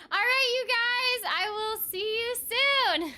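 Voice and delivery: bubbly goodbye. Real, not acted. Bubbly stream sign-off.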